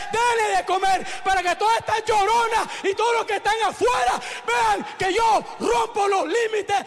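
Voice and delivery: high, loud, shouted proclamation; full-force preaching. Real, not acted. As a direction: drive each phrase home to the congregation.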